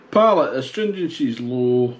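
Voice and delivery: ordinary talk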